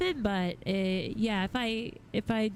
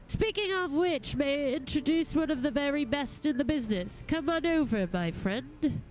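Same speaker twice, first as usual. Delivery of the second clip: higher-pitched courtly gentleman making an introduction; formal gentleman goldsmith; 5 semitones higher than usual. Acted. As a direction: present an honoured colleague with lofty politeness. Reads a game figure's dialogue.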